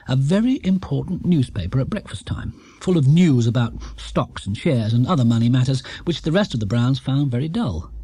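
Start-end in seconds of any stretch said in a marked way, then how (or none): none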